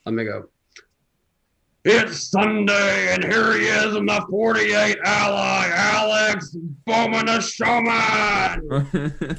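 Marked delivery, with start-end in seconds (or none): 1.8-9.3 slow, loud shouted announcer intro; Monster Jam announcer. Pretend. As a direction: boom the name out to the arena. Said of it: "in your best Monster Jam announcer voice".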